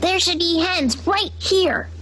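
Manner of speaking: high, slow puzzled voice; a pig frets over his map; worried pig. A narrator's reading. Henry searches the coop, slow and worried.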